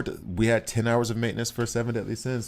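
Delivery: ordinary delivery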